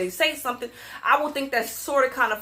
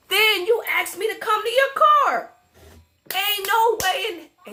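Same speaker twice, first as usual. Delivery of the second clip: high-pitched, incredulous indignation; indignant outburst; 9 semitones higher than usual. Real, not acted. Venting about driver asking her over, indignant outburst. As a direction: build to a high, protesting pitch.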